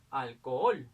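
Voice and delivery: "alcohol" with a loud, lengthened final OL; stress on last syllable. Shown as a demonstration. Teacher locating the tonic syllable, loud stress on the end of "alcohol". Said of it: "la sílaba tónica está aquí".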